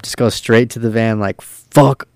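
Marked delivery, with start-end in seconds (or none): 1.4-2.1 louder, drawn-out retold curse; pissed-off outburst. Driver cursing the crew on his way to the van, pissed off. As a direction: imitate someone storming off furious. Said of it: "so pissed".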